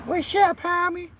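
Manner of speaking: very high "What's up, homie"; squeaky greeting. Acted. Greeting a stream viewer, very high squeak. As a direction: greet them in a tiny squeaky voice.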